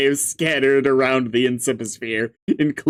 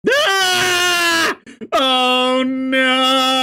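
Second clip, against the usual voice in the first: shocked scream; 9 semitones higher, much slower than usual. Real, not acted. Shocked by missing Dave.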